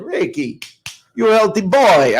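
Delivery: a hint of a Chicago Italian auntie's lilt; Chicago Italian aunt. Nephew recalls his Chicago Italian aunt, lightly done accent. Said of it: "she talked like this".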